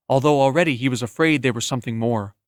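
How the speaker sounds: ordinary delivery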